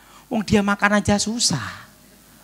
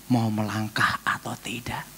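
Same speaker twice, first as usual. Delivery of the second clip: whisper, softer and lower; whispered doubt; 6 semitones lower, 5 dB quieter than usual. Retold or imitated. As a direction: hesitate under your breath. Inner doubt.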